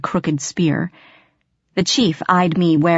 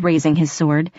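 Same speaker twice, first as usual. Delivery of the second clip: plain reading voice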